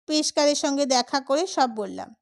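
ordinary delivery, nothing marked

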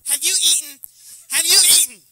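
loud, high mock-mother yell; high-pitched hammed-up nagging; nagging mom impression. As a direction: holler it like an exaggerated fussing mom. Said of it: "gotten that from their mom".

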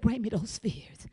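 soft, low unvoiced whisper; God's whisper. God inviting her to hand over her fears, soft whisper. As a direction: breathe it gently like a secret. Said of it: "God said".

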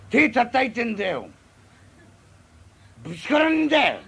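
put-on a drunk annoyed that the clapping fails, loud and high; irritated drunk shout.

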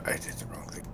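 whispered to himself; whispering. Real, not acted. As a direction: whisper it under your breath. Muttering to himself over dice.

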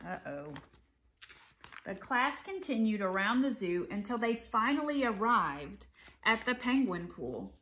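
animated picture-book read-aloud; story narration. A narrator's reading. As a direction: read it to children with clear, lively phrasing.

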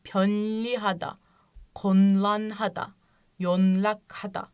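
n ile l ayrı ayrı, yavaş ve kelime kelime; yanlış okunuşları yavaşça sırala; wrong readings. Shown as a demonstration. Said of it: "böyle okuyacaktık". (yanlış telaffuz, n sesi korunuyor)

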